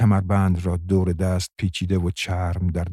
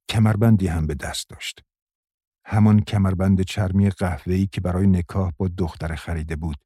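plain reading voice

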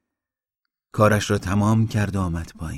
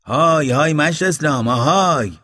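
calling out; 8 semitones higher, 6 dB louder than usual. A narrator's reading. Mashti Baba calling Islam after getting no answer, loud hail. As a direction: call harder to someone who ignored you. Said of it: "دوباره صدایش کرد".

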